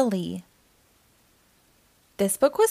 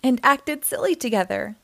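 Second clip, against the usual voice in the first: ordinary delivery, nothing marked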